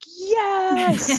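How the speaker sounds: dad spotting the show on the billboard; excited yes. Retold or imitated. Dad sees the arena billboard, cheers excitedly. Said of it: "my dad's like".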